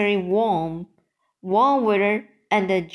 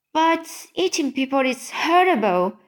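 higher-pitched, mildly upset objection; mild cry of protest; 6 semitones higher than usual. A narrator's reading. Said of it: "Sophie cried".

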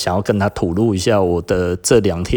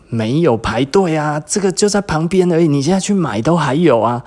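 exasperated protest; 8 semitones higher, 3 dB louder than usual. Retold or imitated. Protesting to a teasing classmate.

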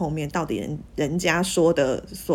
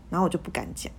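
normal talking voice

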